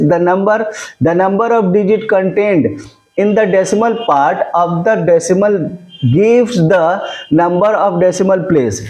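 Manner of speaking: very slow, each phrase spaced out; slow dictation. Shown as a demonstration. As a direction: read it out for students to write down. Dictating definition to students. (धीरे-धीरे बोलकर लिखवाना)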